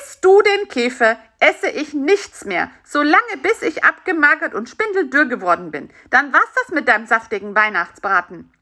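mildly menacing little character; threatening. A narrator's reading. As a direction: lay down a threat, starting high and forceful. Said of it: "drohte Bisi".

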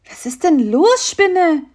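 high, lightly voiced small friend; a curious little companion asking; Bisi character voice.

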